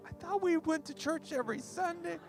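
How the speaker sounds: put-on high, soft, bewildered kid voice; younger self, confused. Son answers his upset parents at dinner, high puzzled voice. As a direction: play it as a puzzled, small-voiced teenager. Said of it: "And I was like".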